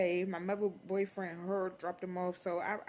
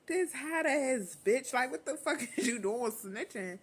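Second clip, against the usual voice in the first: slow, pitched-up outraged hiss; angry whisper-yell; 7 semitones higher, 3 dB louder, slower than usual. Retold or imitated. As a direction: spit out the outrage, slowly. Teen furious at snitching.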